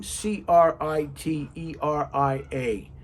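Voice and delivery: slowly spelled letter by letter; slow deliberate spelling. Shown as a demonstration. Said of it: "that's a crazy looking name".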